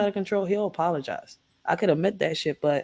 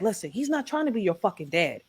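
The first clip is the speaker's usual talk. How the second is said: usual speaking voice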